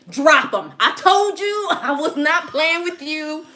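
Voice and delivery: loud, slow laughing order; mock demand. Wife orders husband to drop drawers, loud and slow. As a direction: bark it at him half laughing.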